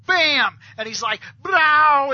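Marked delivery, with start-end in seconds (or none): 0.1-0.6 loud, high punched burst; explosive sound effect. Retold or imitated. Retelling a superpower blast, loud and punchy. 1.4-2.1 loud, high, drawn-out burst; mock blast noise. Imitating the blue wave firing, loud whoosh of a shout.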